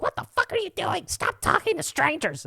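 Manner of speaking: hammed-up angry mom voice; scolding mom. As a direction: snap at the kids like an exasperated mother. Mom scolding kids in store.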